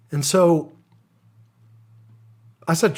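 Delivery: normal talking voice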